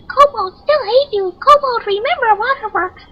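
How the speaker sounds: squeaky high kobold voice. Shown as a demonstration. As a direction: play it as a shrill kobold. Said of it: "this is why I don't do voices".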